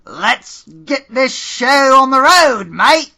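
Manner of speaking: loud, high, slow bad Australian accent; awful Australian accent. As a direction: ham up a terrible Australian accent. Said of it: "an awful attempt at an Australian accent".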